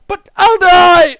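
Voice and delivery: high, loud, drawn-out protest; panicked wail. Acted. Soldier told to jump on a grenade, panicked wail. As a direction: wail it slowly in terror, hammed-up.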